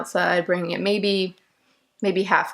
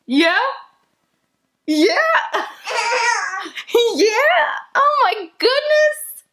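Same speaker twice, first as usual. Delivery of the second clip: squealy delighted coo; 5 dB louder, much slower than usual. Real, not acted. Delighted baby talk, laughing.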